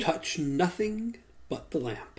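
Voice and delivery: slow eerie whisper. Retold or imitated. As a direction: breathe it slowly like the cave. Quoting the cave guardian.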